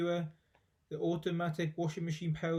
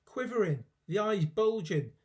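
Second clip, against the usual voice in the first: ordinary delivery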